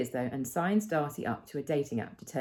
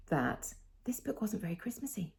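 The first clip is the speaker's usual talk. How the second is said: a breathy confiding whisper, no voice; 2 semitones higher, 6 dB quieter than usual. Real, not acted. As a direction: let it out as a secret aside. Confides opinion in aside.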